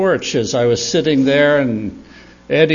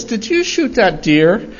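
put-on higher, rising little-kid question; curious little boy; 8 semitones higher than usual. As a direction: lightly imitate a curious small boy asking. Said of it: "so Eddie says".

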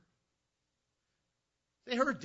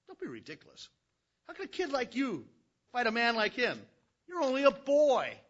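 louder, higher, incredulous scoff; scoffing king; 8 semitones higher, 8 dB louder than usual. Acted. King Saul scoffing at the boy David, incredulous. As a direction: laugh off the kid's offer. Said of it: "Saul answered".

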